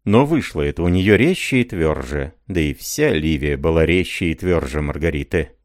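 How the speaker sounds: ordinary delivery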